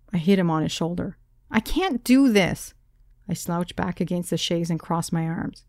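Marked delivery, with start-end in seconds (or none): 1.5-2.7 higher, slow, exasperated; a touch of whiny frustration; frustrated. Acted. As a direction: give up in frustration. Frustrated, giving up.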